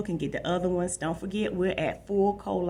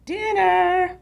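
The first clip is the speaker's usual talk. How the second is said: high, loud call across the house; 13 semitones higher, 7 dB louder than usual. Acted. Mom calls everyone to dinner, high and loud. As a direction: call the family to the table. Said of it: "Mom called".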